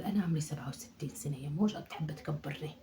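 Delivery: breathy whispered aside, voice dropped away; mock whisper. Old mother whispering her real age to herself, mock secretive whisper. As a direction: whisper it under your breath.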